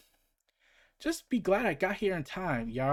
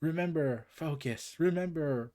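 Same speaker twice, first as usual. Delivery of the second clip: ordinary talk, nothing marked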